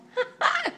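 a quick high-pitched giggle; short amused laugh. Real, not acted. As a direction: burst into a little giggle. Kitten misbehaves on camera.

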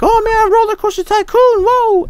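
put-on very high-pitched eight-year-old boy; excited little Johnny. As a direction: squeal it like a thrilled little kid. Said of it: "like, eight-year-old little Johnny".

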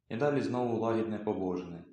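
plain reading voice

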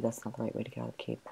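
quick unvoiced whisper; fast whisper. Real, not acted. Lost player whispering about the wrong way out. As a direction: hurry it under your breath.

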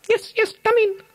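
very high little piglet voice; squeaky welcoming pig. Acted. Third pig welcoming his brothers, very high squeaky piglet voice. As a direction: welcome them in a tiny pig voice.

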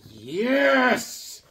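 high, ringing cheer; mock triumphant yes. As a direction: crow it like a triumphant villain.

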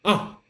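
sharp falling "Ah", like being hit; fourth tone demo. Shown as a demonstration. Teacher acting a startled cry for the fourth tone, falling "Ah". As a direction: snap "Ah" down from high. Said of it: "make a sound like".

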